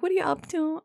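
high nasal put-on question; mock high-pitched small talk; imitating nasal friend. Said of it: "She talks like this".

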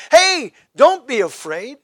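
lightly voiced messenger, high and loud; Gabriel. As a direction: call out a slow, emphatic reassurance. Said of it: "Gabriel said".

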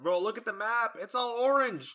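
alarmed and amazed; excited alarm. Real, not acted. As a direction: show a friend the map in disbelief. Shocked at the weather map.

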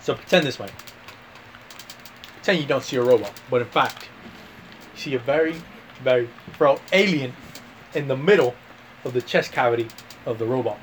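Aussie explainer, pausing between phrases; mock Australian accent. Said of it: "Australia, mate".

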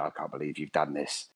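low, quiet seething mum voice; mock-menacing low mother; angry mum. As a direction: imitate a mother's hushed menace. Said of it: "she's like".

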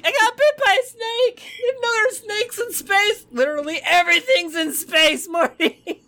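high, strained cartoon scientist; Rick impression. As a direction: squeal it like a frantic cartoon. GM quotes Rick and Morty.

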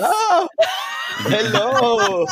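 loud, high, delighted hello; excited greeting. Real, not acted. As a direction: call out a cheerful welcome. Excitedly greeting someone who arrived.

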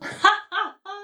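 put-on high burst of child's laughter; loud laughter. A narrator's reading. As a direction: break out laughing loudly. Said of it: "laut zu lachen". (lautes, hohes Kinderlachen)

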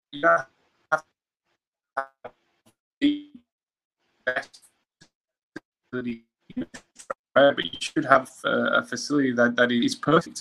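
choppy bad connection; words dropping in and out; cutting out. Real, not acted. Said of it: "you're cutting out".

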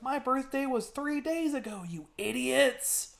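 high-pitched imitation of a kid's outburst; high, mock-scolding retort; mock indignant kid.

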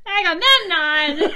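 loud, slow, laughing exclamation of the name; laughing disbelief. Real, not acted. Friend reacting to the joke, laughing loud. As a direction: shout the name while cracking up. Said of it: "Oh my god".

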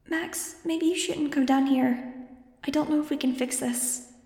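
put-on a worried child speaking faintly through a thought; hushed troubled child's voice; troubled little girl. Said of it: "Angel's fuzzy, troubled thought".

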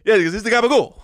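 gabagool in a hammed-up New Jersey Italian accent; playful New Jersey Italian gabagool; mock Jersey accent. Gabagool Sopranos accent bit.

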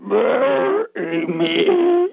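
very high, very slow crying wail; a ghost's long sobbing plea to be buried. Retold or imitated. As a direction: drag it out like a decaying ghost begging. Rotting ghost begging in dream.

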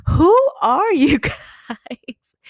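loud, drawn-out incredulous question; imitated bewildered friend; retold disbelief. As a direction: stretch every word in mock astonishment, high and breaking into a laugh.

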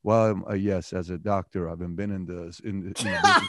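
low, halting stuffy-scholar voice; boring historian. Acted. Host mocking how a historian would talk, low and boring. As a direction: drone on haltingly like a dull academic. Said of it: "a historian's gonna talk like this".

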